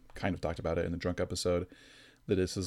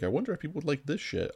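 lightly acted wondering, pitched higher; higher-pitched mock curiosity; mock musing; 6 semitones higher, faster than usual. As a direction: imitate someone idly wondering, voice lifted. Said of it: "and was like".

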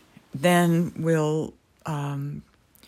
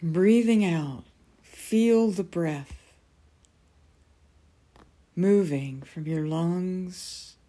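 guided meditation. Acted. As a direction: ease them gently into calm.

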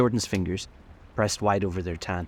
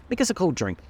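a high bright call for drinks, much higher in pitch; cried out; 11 semitones higher than usual. A narrator's reading. Daisy calls to Tom for a cold drink, high cry. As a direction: cry it out high, calling across the room. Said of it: "cried Daisy".

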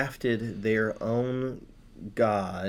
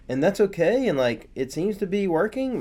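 sudden realization; 7 semitones higher than usual. Acted. Danites realizing they can do whatever they want, high and gleeful. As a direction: play the Danites cheerfully spotting a loophole. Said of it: "it's kind of like they realize".

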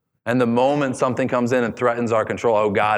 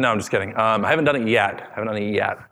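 ordinary talk, nothing marked

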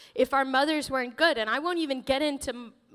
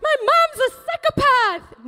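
put-on higher and louder whiny kid voice; imitating a bratty child; mock kid complaint; 12 semitones higher, 8 dB louder than usual. Kids about their mother, mock bratty whine. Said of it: "we would be like".

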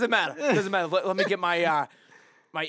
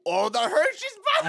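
excited laughing; 12 semitones higher, 4 dB louder than usual. Real, not acted. Joking excitement.

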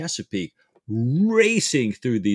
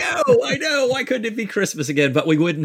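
mock lament; 12 semitones higher, faster than usual. Real, not acted. Host playfully lamenting no Christmas.

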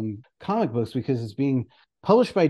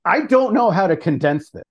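louder, higher-pitched mock panic; retold freak-out, pleading at a raised pitch; 5 semitones higher, 6 dB louder than usual. Writer texting a friend about condensing the script, freaking out. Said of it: "freaking out".

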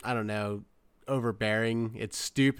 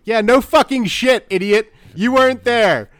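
loud exasperated rant, high; mock yell; 10 semitones higher, 12 dB louder than usual. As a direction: yell it like you're fed up. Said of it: "It was just like".

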